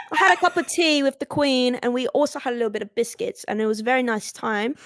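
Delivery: put-on British storyteller; British accent. Showing off tea with the Queen, put-on British accent. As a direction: do a playful mock-British voice. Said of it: "I just put on a British accent".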